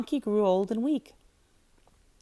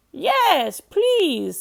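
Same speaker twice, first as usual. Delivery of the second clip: higher, louder eager yes; excited rooster; 8 semitones higher, 7 dB louder than usual. A narrator's reading. Rooster flaps his wings, excited high voice. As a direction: burst out with eager joy.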